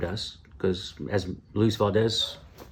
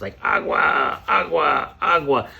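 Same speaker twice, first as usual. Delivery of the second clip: drawn-out, mildly strained repeated cry; hammed-up gurgling repetition; mock waterboarding; 4 semitones higher, 3 dB louder, slower than usual. Kids enduring Spanish immersion like waterboarding, mock strained.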